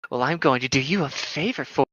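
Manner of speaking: ordinary talk, nothing marked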